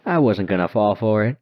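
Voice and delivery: ordinary delivery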